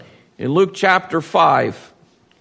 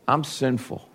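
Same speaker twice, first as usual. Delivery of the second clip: much softer, fading repeat; softer confession; 2 semitones lower, 7 dB quieter than usual. Retold or imitated. Peter confessing again to the Lord, much softer. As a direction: let the repeat fall away softly. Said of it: "he confessed".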